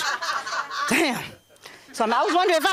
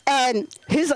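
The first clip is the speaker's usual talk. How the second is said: ordinary talk, nothing marked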